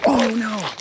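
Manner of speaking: high sudden yelp of surprise; startled cry at the fish; surprised. Real, not acted. Bass strikes and gets mad.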